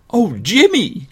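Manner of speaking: put-on high little-girl voice; delighted high-pitched exclamation; girl crying out. Girl calling her brother to see a puppy, high cry. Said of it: "cried the girl".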